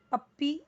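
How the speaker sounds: forceful पप्पी, holding the pp before a rising ee; overdone double P. Shown as a demonstration. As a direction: misread पप्पी, punching the second syllable upward. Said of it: "पप्पी ऐसे नहीं पढ़ना है".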